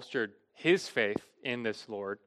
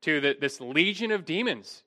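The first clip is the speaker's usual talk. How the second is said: ordinary talk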